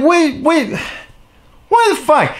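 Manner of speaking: high mock bewilderment; baffled reaction. Retelling baffled reaction to forum.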